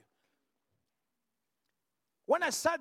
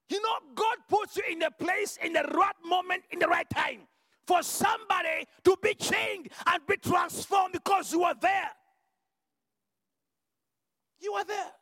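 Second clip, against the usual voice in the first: full-force preaching; 4 semitones higher than usual. Acted. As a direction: drive it home to the crowd.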